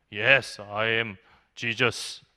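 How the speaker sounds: very slow, low, solemn declaration; psychiatric patient as Jesus. Retold or imitated. Patient in psych ward tells student he is Jesus, slow and low. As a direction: drag out each word like a self-declared messiah.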